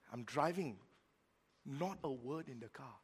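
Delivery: normal talking voice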